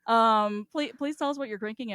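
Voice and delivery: normal talking voice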